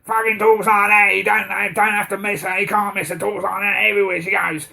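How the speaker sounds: hint of a brash loudmouth mum; loudmouth impression. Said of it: "who's that fucking loudmouth".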